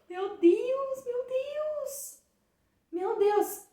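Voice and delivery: gasped, very high-pitched cry of amazement; riso em meio à dor. Retold or imitated. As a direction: re-enact overwhelmed, breathless awe, voice way up high. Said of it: "comecei a rir, assim, no meio da dor". (exclamação aguda imitando riso e choro)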